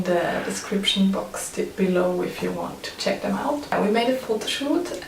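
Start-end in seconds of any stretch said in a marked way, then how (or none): none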